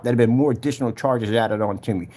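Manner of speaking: usual speaking voice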